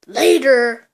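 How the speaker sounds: loud, punchy farewell; shouted sign-off; loud goodbye. Pretend. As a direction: call it out as you leave. Leaving loudly.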